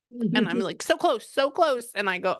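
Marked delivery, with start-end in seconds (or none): 0.8-1.9 high, slow quoted exclamation; mock exasperation. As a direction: stretch it out high, as if nearly there.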